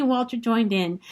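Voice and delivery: ordinary delivery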